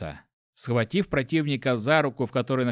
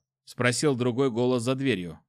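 ordinary delivery, nothing marked

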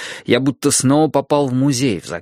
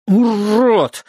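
gurgled through water; gurgling; 9 semitones higher, 4 dB louder than usual. Acted. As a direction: spit it out with water in mouth. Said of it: "пробулькал я".

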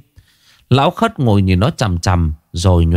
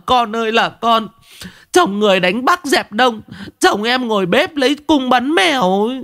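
high-pitched scolding wife's lament; shrewish wailing; 12 semitones higher than usual. A narrator's reading. Wife berates her husband before the whole village, shrewish. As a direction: wail and nag at the top of your range. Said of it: "giọng chua ngoa".

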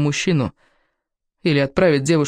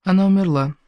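higher, restrained grief; tearful; 4 semitones higher than usual. Acted. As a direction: break the death news with a touch of tears. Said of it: "сообщила я Уэстри со слезами на глазах". (сдержанно, со слезами в голосе)

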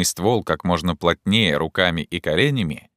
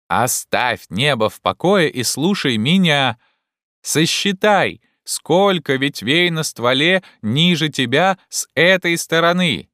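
high, raised, commanding call; calling up orders; 9 semitones higher, 4 dB louder than usual. Acted. Legrand tells Jupiter to count branches, calling up. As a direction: send each instruction up to the climber.

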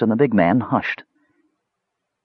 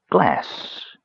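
dismissive remark; 4 semitones higher, 3 dB quieter than usual. A narrator's reading. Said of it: "remarked the mage".

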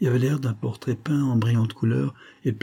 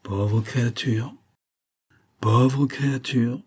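slow, pitying sigh of words; compassionate, lingering lament; 3 semitones higher, slower than usual. A narrator's reading. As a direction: draw it out with sorrowful sympathy. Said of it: "dit le major avec un soupir de compassion".

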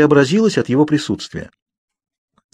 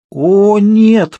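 a loud, high mortified groan; 10 semitones higher, 6 dB louder than usual. A narrator's reading. Bella learns she talks in her sleep, mortified groan. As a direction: cry out in embarrassment. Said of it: "простонала я".

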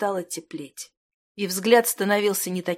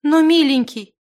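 put-on high, loud, tearful girl's plea; desperate coaxing in a raised voice; tearful pleading; 8 semitones higher, 10 dB louder than usual. A narrator's reading. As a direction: plead urgently through tears. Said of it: "подбежала к нему со слезами Зимана".

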